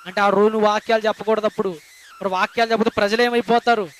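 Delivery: usual speaking voice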